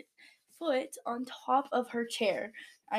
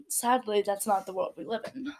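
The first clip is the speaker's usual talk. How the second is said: ordinary delivery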